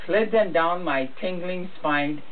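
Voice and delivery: plain reading voice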